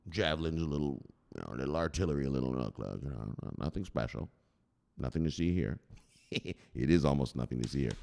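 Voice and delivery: low, soft sly downplaying; softly playing down the weapons; mock hush. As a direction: murmur it like a secret.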